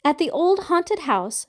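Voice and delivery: ordinary delivery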